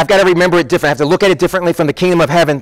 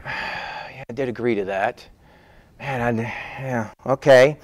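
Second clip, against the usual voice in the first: very soft, low, deflated mumble; grudging acceptance; 8 semitones lower, 10 dB quieter than usual. Acted. Worker reminded of what he agreed to, grudgingly resigned. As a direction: concede it reluctantly under your breath. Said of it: "not real happy with it".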